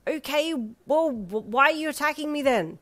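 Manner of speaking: put-on lifted, nervous young voice; a startled traveller backing away. A narrator's reading. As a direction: ask it wary and a bit breathless. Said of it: "you asked".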